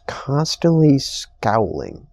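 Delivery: ordinary talk, nothing marked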